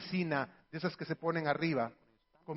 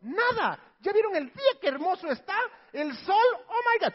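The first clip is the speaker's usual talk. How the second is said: mucho más agudo, entusiasmo exagerado; sobreactúa la alegría; exageradamente feliz; 10 semitones higher, 3 dB louder than usual. Acted. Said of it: "Tampoco exageres". (madre a sus hijos, exageradamente feliz)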